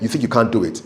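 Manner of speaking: normal talking voice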